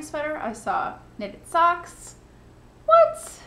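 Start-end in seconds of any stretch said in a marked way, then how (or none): 2.8-3.4 high, loud, incredulous yelp; playful high-pitched shout of astonishment; mock amazement. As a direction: burst out in exaggerated disbelief. Mock amazement at all she saw.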